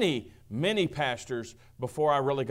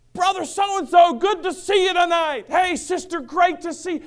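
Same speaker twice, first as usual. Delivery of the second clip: much higher, louder greetings across a parking lot; hearty yelling greeting; 13 semitones higher, 8 dB louder than usual. Retold or imitated. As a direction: call out to everyone like an overly outgoing pastor. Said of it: "practically yelling at people".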